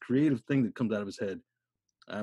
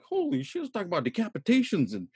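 shocked; 10 semitones higher than usual. Retold or imitated. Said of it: "I'm like".

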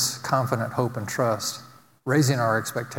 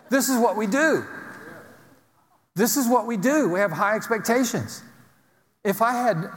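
higher, rousing preacher's cadence; full-force preaching; 7 semitones higher than usual. Acted. Pastor rousing his congregation, full-force preaching.

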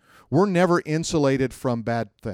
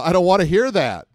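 loud, high-pitched refusal; mock protest; 7 semitones higher, 7 dB louder than usual. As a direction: protest loudly, hamming up the annoyance. Acted refusal to hear bad news.

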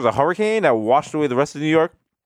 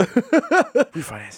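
drawn-out mock homeowner savoring the word; slow adult money talk; 3 semitones higher, much slower than usual. Parodying his son's old grown-up topics, slow. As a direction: stretch it like a dad proud of his finances.

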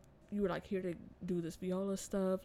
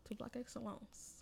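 whispered segment cue with no voice; whispered, a little higher; 4 semitones higher, 8 dB quieter than usual. Real, not acted. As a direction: breathe the segment name like a secret. Cueing the next segment.

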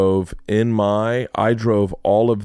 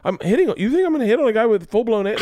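mocking himself; 12 semitones higher, faster than usual.

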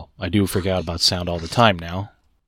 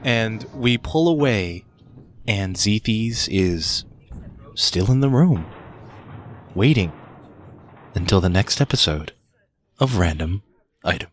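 episode sign-off narration. Acted. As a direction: draw out each phrase to a close. Game master closes the episode.

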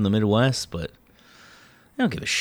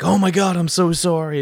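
over-apologetic voice; 8 semitones higher than usual. Retold or imitated. Imitating over-apologetic employee.

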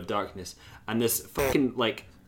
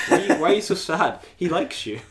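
mock incredulous; 7 semitones higher, 6 dB louder than usual. Asking the princess incredulously.

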